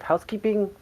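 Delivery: a high, hammed-up Spanish accent asking at the door; generic Spanish accent. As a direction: put on a cheesy Spanish accent as housekeeping. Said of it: "I say in my best, uh, generic Spanish accent".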